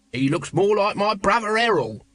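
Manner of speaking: high, cheeky, lovable chancer; cheery little city sparrow voice; chirpy city sparrow. A narrator's reading. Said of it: "playing the cheapy, chirpy, lovable city sparrow".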